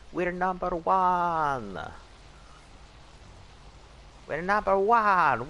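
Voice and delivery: high, slow, drawn-out gloat; gloating villain voice. Acted. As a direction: crow it out like a cartoon villain. Gloating chant, Waluigi-like.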